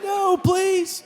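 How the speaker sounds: high, hammed-up begging; mock pleading. Cats begging God after the curse, mock pleading.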